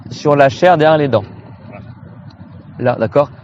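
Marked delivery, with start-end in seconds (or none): none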